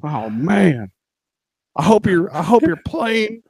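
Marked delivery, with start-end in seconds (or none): 1.8-3.4 high-pitched bad Texas accent; badly faked Texas accent; attempted Texas accent. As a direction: try a hammed-up Texan voice, up high. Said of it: "I'm trying to do a Texas accent".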